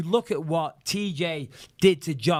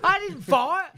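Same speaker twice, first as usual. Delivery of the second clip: retold angry outburst; much slower than usual. DJ yelling at the clubgoers who blamed him, high hammed-up fury. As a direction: fire it back, pitched way up in a hammed-up rage. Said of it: "I was so pissed".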